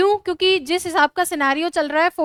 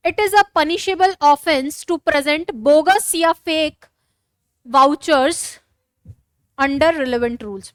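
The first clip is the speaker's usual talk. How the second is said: slow dictation; much slower than usual. Shown as a demonstration. Teacher dictating model answer.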